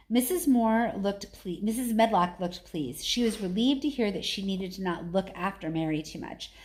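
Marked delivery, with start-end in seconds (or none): none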